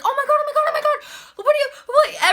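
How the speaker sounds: high, loud mock panic; hammed-up panicked squealing; imitated freak-out. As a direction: gabble it like a panicking crowd. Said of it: "Everyone's freaking out, like".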